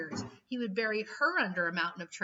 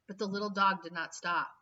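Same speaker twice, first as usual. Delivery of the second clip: ordinary delivery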